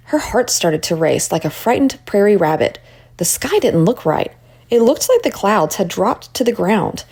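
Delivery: ordinary delivery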